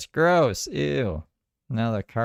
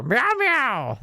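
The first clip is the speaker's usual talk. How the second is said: high-pitched imitation of a frantic meow; hammed-up panicked cat cry; mock freak-out meow; 13 semitones higher, 3 dB louder than usual. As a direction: meow way up high, mimicking a meltdown. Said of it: "freaking out in the car here".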